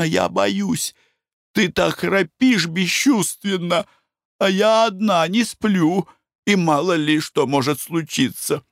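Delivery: high, slow, fearful pleading wife; a nervous wife begging; anxious wife. Acted.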